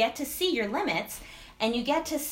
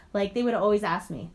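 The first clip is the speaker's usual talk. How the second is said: usual speaking voice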